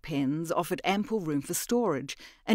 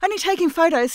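much higher and louder, nervous brightness; brave front; 12 semitones higher, 8 dB louder, faster than usual. Acted. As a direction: bluff it with a brave smile. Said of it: "She smiled bravely".

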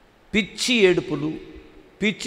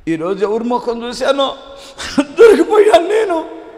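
high, loud half-laughing sob, slowing down; mock sobbing; 5 semitones higher, 6 dB louder than usual. Thief sobbing over being caught, hammed-up high wail. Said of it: "అన్నాడంట".

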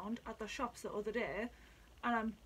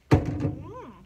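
loud tasting mm; 9 semitones higher, 12 dB louder than usual. Real, not acted. First sip of liqueur.

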